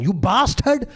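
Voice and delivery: high mock yell, Indian accent; hammed-up outrage in an Indian accent; angry Indian accent. Neighbour cursing in an argument, mock-angry Indian accent. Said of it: "he was just going ape shit".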